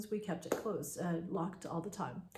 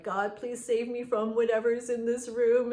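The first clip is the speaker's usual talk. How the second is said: frightened prayer; 4 semitones higher, 6 dB louder than usual. Retold or imitated. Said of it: "I start praying to God".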